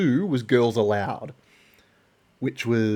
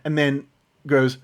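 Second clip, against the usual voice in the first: usual speaking voice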